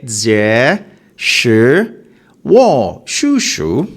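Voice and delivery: 这 said slowly with a falling tone; slow tone practice. Shown as a demonstration. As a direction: drag out 这 and let it fall. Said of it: "it's a falling tone".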